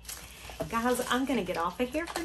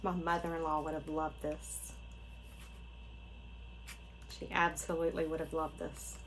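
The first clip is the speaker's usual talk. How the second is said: softer, lower, wistful; subdued, tender remembrance; a little emotional; 4 semitones lower, 8 dB quieter than usual. Real, not acted. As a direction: hold back feeling while remembering a loved one. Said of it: "I just got a little emotional".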